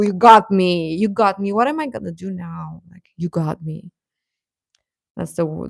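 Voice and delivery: ordinary talk, nothing marked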